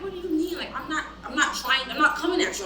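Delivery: acted-out baffled defensiveness; defensive protest. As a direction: protest innocence, building in volume. Acted reply to tone accusation.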